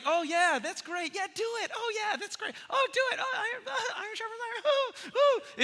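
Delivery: squeaky laughing flattery, half-mocking; fake yes-man friend. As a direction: lampoon a friend who approves everything. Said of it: "if everything's like".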